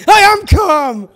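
high, slow, drawn-out yell; hammed-up stressed-out outburst; least calm voice. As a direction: shout it in comic denial, stretching the words. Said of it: "in the least calm voice".

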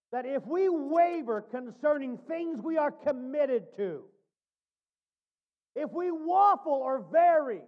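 loud, rising pulpit preaching. Acted. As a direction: preach it out to the pews. Preaching to the congregation.

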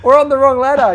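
high, very loud mock yell; shouted warning. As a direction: yell the news down to your mates. Shouting warning to mates, retold.

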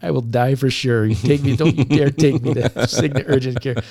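wounded cop mock-protesting urgent care; mock panic. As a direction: protest in joking alarm. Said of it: "Like, you know".

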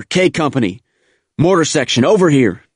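high, loud command calls; shouted orders. A narrator's reading. Officer rallying first platoon on the beach, shouting orders. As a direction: call the troops over, shouting. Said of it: "yelled and shouted orders".

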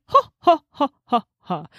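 retelling Fawn's evil cackle, hammed up. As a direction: cackle like a gleeful villain. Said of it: "Not this cackle".

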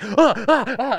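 high, loud flustered laughing gasps; laughing panicked ah. Retold or imitated. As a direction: fumble in a giggly panic. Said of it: "it was, like, a classic".

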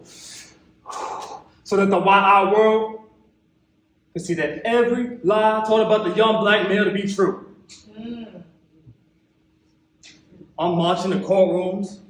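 ordinary talk, nothing marked

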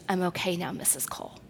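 soft, fading whisper of thanks; hushed girl. Retold or imitated. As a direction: whisper it like a teen just calmed down. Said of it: "she goes".

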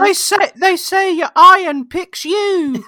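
high, loud put-on wizard kid; eager young wizard. Young wizard at the gun shop, hammed-up wonder. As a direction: marvel in a squeaky voice, drawing it out.

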